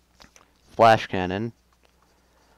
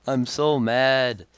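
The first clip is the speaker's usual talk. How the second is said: drawn-out frustration; 2 semitones higher, 4 dB louder, much slower than usual. Real, not acted.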